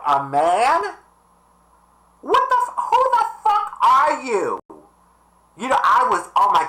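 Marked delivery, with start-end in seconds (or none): 2.2-4.6 high, stunned outburst; shocked who-the-hell cry; outraged disbelief. Retold or imitated. Woman offends her at funeral, so a high outraged outburst.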